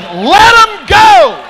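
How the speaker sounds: very high, drawn-out preacher scream; full-force screamed order; excited screaming. Retold or imitated. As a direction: scream the command, stretching each word. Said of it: "I'm screaming 'cause I'm excited".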